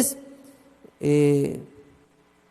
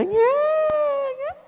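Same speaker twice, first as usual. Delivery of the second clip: very high whining siren sound; siren imitation. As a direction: mimic the wailing alarm siren.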